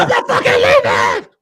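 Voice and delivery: screamed high and loud, mocking a tantrum; defiant shout. Mocking Vince's speech as a tantrum, high defiant shout. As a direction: yell it like a man who won't budge. Wolf of Wall Street scene quote.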